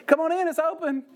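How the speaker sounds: high-pitched bird calling out; talking bird. Acted. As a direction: chirp an invitation like a pet bird. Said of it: "I could hear the bird say".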